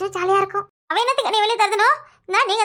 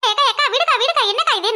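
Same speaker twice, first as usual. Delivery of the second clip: pleading to stop; 4 semitones higher than usual. Acted. Sister pulls her away from the quarrel.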